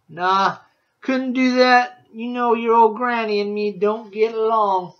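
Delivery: put-on loud, high, backwoods old woodsman drawl; old woodsman Rancid. A narrator's reading. Woodsman tells the boy about Christmas trees, loud backwoods voice. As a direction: boom it out like a grizzled mountain man.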